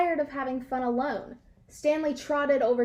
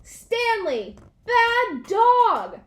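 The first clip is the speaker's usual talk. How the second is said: much louder, higher angry shout; very loud scolding; 9 semitones higher, 7 dB louder, much slower than usual. A narrator's reading. Owners come home to the party, shouting angrily. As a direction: bellow the scolding. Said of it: "said together very loud".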